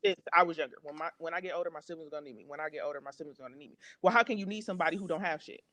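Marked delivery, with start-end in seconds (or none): 0.8-2.4 repeated mantra. Retold or imitated. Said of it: "his training". 2.5-3.8 much softer, repeated lesson trailing away; muttered mantra. Retold or imitated. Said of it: "his training".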